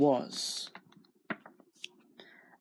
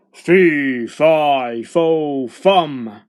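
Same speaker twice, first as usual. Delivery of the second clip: very loud, heavy chant; thundering storybook giant; roaring giant; 8 dB louder, much slower than usual. A narrator's reading. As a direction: roar each word as a separate blow. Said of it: "roared the giant".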